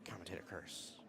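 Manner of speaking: hushed, joking whisper; mock whisper. Commentator teasing a jinx on the match, whispered.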